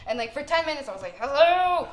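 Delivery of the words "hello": louder, higher, exasperated hello; mock yell to wake the driver; loud call. Ten minutes calling sleeping driver.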